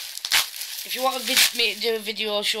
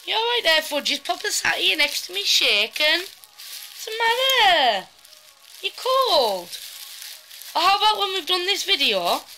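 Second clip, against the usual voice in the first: pet talk; 8 semitones higher than usual. Real, not acted. Comforting the shaking dog.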